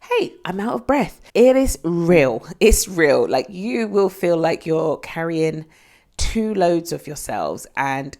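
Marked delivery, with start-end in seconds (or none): 0.0-1.2 higher exasperated Hey, gasping; mock surprise. Shown as a demonstration. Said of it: "I can try and roll out of bed". 1.3-3.3 louder, slow, insisting on real; emphatic. Real, not acted. Insisting on pregnancy symptom.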